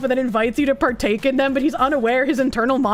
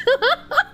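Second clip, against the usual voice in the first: short burst of high laughter; amused laughter; 10 semitones higher than usual. Real, not acted. Laughs at chat message.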